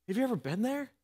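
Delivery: hushed personal question to the congregation; soft question. Real, not acted. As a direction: draw the listener in gently. Repeated question, much softer.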